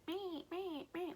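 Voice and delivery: high little meh sounds like a limp newborn; mock weak baby whimper. Mother imitating her newborn's weak cry, high mock whimper. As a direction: squeak out a feeble baby cry.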